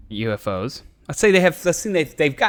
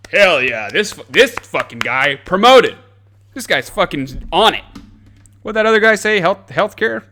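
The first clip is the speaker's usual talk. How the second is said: rowdy cheer; 5 semitones higher, 11 dB louder than usual. Acted. Government cheering nuke builder.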